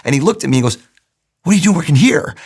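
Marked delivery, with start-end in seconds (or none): 1.4-2.4 retold astonished question, higher and louder; mock surprise from the boss; surprised boss.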